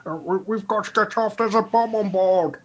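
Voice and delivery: high, loud, rushed cry of alarm; panicked warning. Acted. Studley urging a man off the train, panicked high yell.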